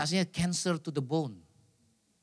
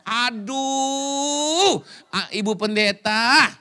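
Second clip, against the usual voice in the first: 1 playful exclamation; 4 semitones higher, 7 dB louder, much slower than usual. Real, not acted. Teasing the pastor's wife aloud.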